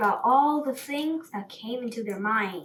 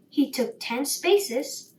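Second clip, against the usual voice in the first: usual speaking voice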